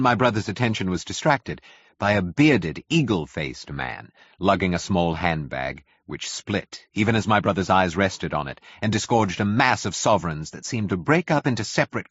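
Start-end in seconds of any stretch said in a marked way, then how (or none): none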